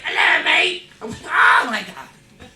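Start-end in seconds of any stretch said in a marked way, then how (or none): 0.0-0.8 high, loud mock British rocker greeting; imitating a rock star, pitched way up. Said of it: "He's like". 1.2-2.5 loud, high, drawn-out amazement; hammed-up awe; starstruck. Retells starstruck reaction to [name].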